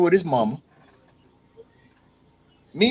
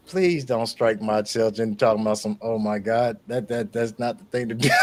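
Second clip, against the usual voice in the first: hushed mock prayer; 9 semitones lower, 7 dB quieter than usual. Real, not acted. Mock plea to God.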